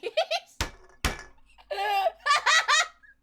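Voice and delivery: big loud laugh; loud high laugh. Real, not acted. Laughing at shirt.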